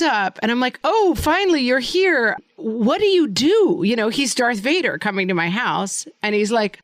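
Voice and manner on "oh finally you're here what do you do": higher-pitched, put-out welcome to a latecomer; retold exasperation, pitched up; mock exasperated greeting. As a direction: act out an impatient host questioning the hire. Acts out greeting the late performer.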